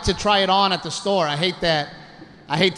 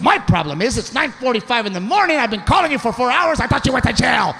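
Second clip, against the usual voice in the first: raised-pitch scolding tirade; mock angry rant, building without a break; pissed wife; 6 semitones higher than usual. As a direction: impersonate a wife fed up after waiting all night. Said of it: "She was pissed".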